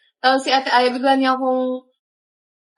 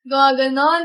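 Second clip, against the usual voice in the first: ordinary delivery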